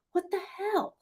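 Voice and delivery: high, pinched what-the-hell; exasperated disbelief. Real, not acted. Psychic ranting at TikTok readers, exasperated. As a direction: throw up your hands at it.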